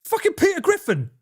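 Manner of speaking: high-pitched exclamation; exclaiming. Real, not acted. Streamer reacting to the accent video, exclaiming.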